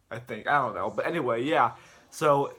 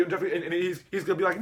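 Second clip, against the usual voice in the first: plain reading voice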